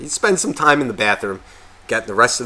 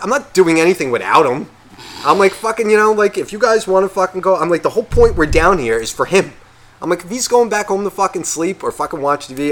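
raised and higher, heated; 6 semitones higher than usual. Retold or imitated. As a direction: argue back insistently. Arguing with groomsmen.

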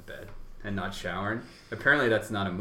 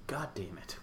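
whispered mock curse; under breath; 9 dB quieter, slower than usual. Retold muttered frustration.

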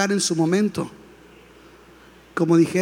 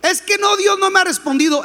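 whiny complaining believer; 11 semitones higher than usual. Retold or imitated.

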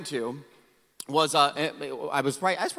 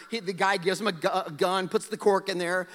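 ordinary delivery

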